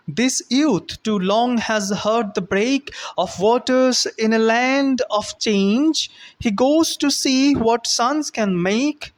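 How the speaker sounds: slow, measured recital, pitched a little up; careful poem reading. Shown as a demonstration. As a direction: read the verse aloud slowly and carefully. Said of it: "So let us try to read the poem properly".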